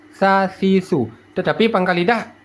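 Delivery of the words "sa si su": tongue tip slightly out on "Sa, si, su"; tongue-tip tha. Shown as a demonstration. Teacher showing placement, "Sa, si, su" lisped through the teeth. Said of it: "keluar sedikit ujung lidah".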